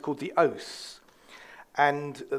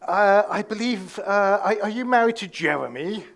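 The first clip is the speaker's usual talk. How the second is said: posh English lady, pitched higher; posh English accent; 4 semitones higher, 5 dB louder than usual. Retold or imitated. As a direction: inquire like an upper-crust English lady. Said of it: "They don't speak like that normally".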